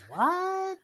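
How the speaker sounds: high, shocked squeak of disbelief; stunned what. Retold or imitated. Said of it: "And I'm thinking".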